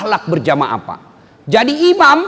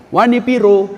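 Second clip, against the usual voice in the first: TV ad impression. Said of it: "seperti itu iklan yang di televisi".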